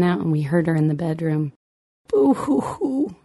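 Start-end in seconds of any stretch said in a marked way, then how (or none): 2.1-3.2 high, slow sung-out boo-hoo; a child playing at crying; high, slow pretend blubbering; mock sobbing. A narrator's reading. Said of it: "she cried".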